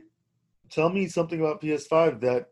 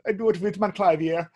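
mock German accent; lightly camp German accent; 4 semitones higher than usual. Shown as a demonstration. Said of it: "talk with a little German accent".

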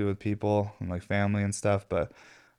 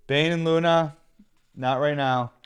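raised voice, firm call; calling to dogs; 6 semitones higher, slower than usual. Real, not acted. Scolding the barking dogs.

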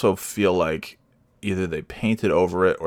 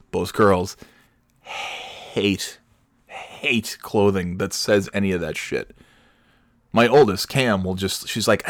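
ordinary talk

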